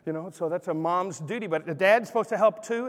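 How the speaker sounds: ordinary talk, nothing marked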